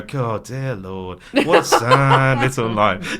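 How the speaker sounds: slow quote broken up by laughs; quoting through laughter. Retold or imitated. Mates all quoting the famous rant, laughing. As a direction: laugh while reciting the line. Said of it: "We all go".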